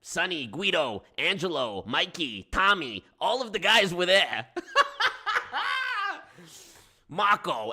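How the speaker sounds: loud, laughing roll call of wiseguy names; New York accent. Pretend. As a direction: Brooklyn mobster listing his crew, amused. Listing mob friends.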